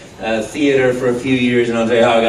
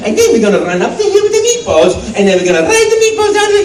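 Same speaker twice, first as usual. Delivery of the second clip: high, excited Italian cartoon voice; Mario; 4 dB louder than usual. Rambling through an audition, high excitable Italian character. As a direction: babble gleefully in high-pitched Italian. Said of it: "I'm making up this".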